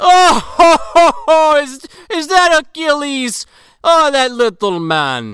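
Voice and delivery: high, hammed-up mocking shout; gloating "oh ho ho" entrance, loud at first; strutting Alexander. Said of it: "Alexander fucking struts in".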